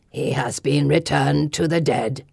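low, very slow creature growl; gargoyle growl. A narrator's reading. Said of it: "he growls".

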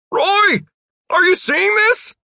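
loud, very high call; high-pitched, excited holler; excited shout. A narrator's reading. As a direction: shout down to show off. Jeff calls down to Roy.

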